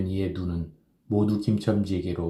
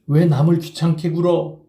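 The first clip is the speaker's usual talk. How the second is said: louder, mocking repeat of the girl's line; scornful mockery; 8 semitones higher, 7 dB louder than usual. A narrator's reading. Kim mocking the schoolgirl's refusal, scornful and louder. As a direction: sneer her words back. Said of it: "빌어먹을 깍쟁이 같은 년".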